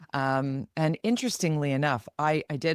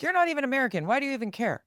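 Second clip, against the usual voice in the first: high, mocking put-down; dismissive scoffing; 9 semitones higher, much faster than usual. Critics telling a Canadian to butt out, mock sneer. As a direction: mimic a sneering critic, pitched way up.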